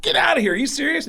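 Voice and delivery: very high-pitched incredulous reaction; retold amazement, voice jumping way up; astonished disbelief. Comedian hearing a rock star loves his act, high disbelief.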